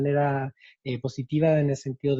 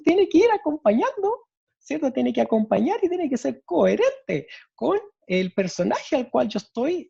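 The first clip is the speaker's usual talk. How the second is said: much higher, squeaky little chick; 10 semitones higher than usual. Shown as a demonstration. Teacher demonstrating a chick's voice to students, high and small. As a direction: talk like a tiny baby chick. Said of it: "la historia de un pollito pequeñito".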